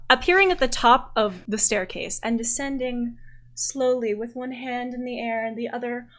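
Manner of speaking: ordinary talk, nothing marked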